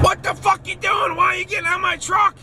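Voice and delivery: high, yelling outburst at someone climbing on his truck; retold angry yell from the driver's seat; angry shout. Joe yells at narrator climbing truck.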